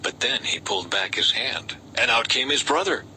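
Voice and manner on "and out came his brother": usual speaking voice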